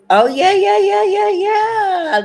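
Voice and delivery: high, loud excited squealing. Real, not acted. Excited about trucker lifestyle.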